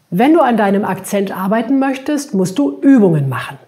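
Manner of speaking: "Übungen" lifted and leaned on; stress on Übungen. Shown as a demonstration. Teacher shows which word carries the focus, stressing "Übungen". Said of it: "Hier habe ich das Übungen betont".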